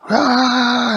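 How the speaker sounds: high, loud startled scream. Acted. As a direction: shriek in fright. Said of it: "And the boys screamed".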